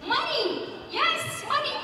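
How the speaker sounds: high, loud triumphant exclamation; excited reveal. Real, not acted. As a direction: announce the answer like a winner. Speaker reveals answer to audience.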